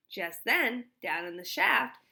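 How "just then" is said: plain reading voice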